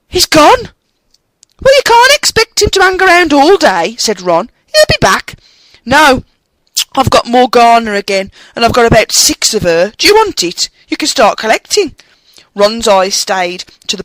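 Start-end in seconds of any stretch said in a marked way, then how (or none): 0.1-0.7 high, loud surprised exclaim; astonished cry. Acted. Harry shocked at empty card. 1.6-5.4 higher, louder casual schoolboy; Ron's boyish voice. Acted. Ron voiced as character. 5.8-11.9 put-on higher, louder boyish offer; chatty kid sharing cards; lightly voiced Ron.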